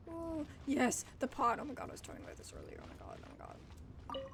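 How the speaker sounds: ordinary delivery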